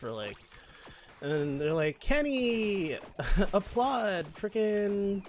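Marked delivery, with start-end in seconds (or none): 2.0-5.2 higher, louder called-out name; retold award announcement. Leaders naming the award winner, retold award announcement. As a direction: call the winner up, mock-announcer.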